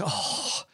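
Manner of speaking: breathed-out, voiceless "oh"; resigned exhale, acted; reluctant sigh. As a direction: sigh it out before giving in. Leader reluctantly gives in to telling.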